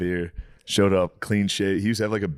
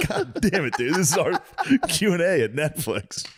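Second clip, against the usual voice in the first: high, half-laughing groan; laughing exasperation; 10 semitones higher than usual. Retold or imitated. Telling how he groaned at the German's panic, laughing. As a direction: laugh through mock despair.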